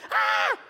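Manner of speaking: very high, loud scream; freaked-out scream. Acted. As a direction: scream in hammed-up panic. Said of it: "you're freaking out".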